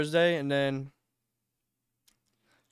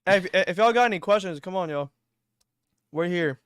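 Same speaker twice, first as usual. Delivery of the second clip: talking through a mouthful of food; words mushed around a bite; chewing while talking; 3 semitones higher, 7 dB louder than usual. Real, not acted. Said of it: "A S M R of [name] eating".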